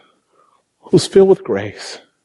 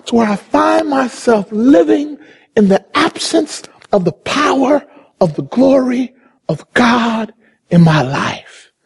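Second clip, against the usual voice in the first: ordinary talk, nothing marked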